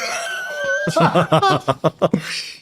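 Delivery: big high burst of laughter. Real, not acted.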